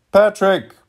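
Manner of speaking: high-pitched shout for service; calling out. A narrator's reading. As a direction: break off to summon the servant in a high cry. Man calling for his servant.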